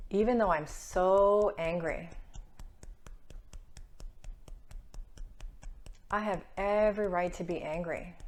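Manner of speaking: loud, slow, emphatic statements for listeners to repeat; tapping affirmation. Acted. Coach leading listeners to voice their anger, firm affirmation voice. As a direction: declare each line firmly so they can echo it.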